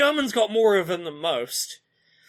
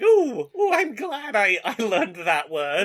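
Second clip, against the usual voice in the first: delighted gushing; 3 dB louder, slower than usual. Retold or imitated. A delighted German thanking the English tourist. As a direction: gush with delight at the foreign word. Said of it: "It's like".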